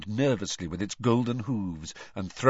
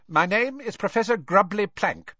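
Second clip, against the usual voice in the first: brisk stern witch, pitched high; 11 semitones higher, 3 dB louder than usual. A narrator's reading. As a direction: clip through it briskly. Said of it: "she said briskly".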